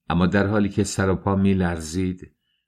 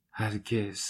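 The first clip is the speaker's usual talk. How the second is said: "هرگز" repeated far softer, trailing down; fainter whispered echo; 10 dB quieter, slower than usual. Acted. Dunya echoes her refusal to Svidrigailov in a fading whisper. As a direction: let the second "هرگز" fade to a bare whisper. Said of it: "دختر زمزمه کرد".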